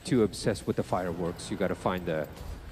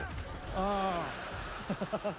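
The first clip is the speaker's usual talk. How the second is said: light giggle, much higher and softer; a quick amused giggle; high soft laugh; 10 semitones higher, 9 dB quieter than usual. Real, not acted. Enemy looking away, amused.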